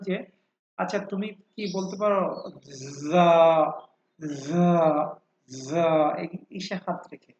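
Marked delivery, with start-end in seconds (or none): 3.1-5.9 যা with a long buzzing z onset; voiced sound. Shown as a demonstration. Teacher shows students the throat vibration, voiced buzzing যা. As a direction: draw out a humming z into যা. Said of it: "প্রথমটা কিন্তু voiced".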